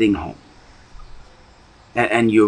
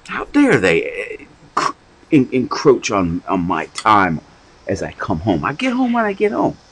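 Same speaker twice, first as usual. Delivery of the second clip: indignant grumble; 3 semitones higher than usual. Acted. Preacher voicing a spouse muttering angrily after storming off. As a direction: huff about them alone. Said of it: "you storm off in anger".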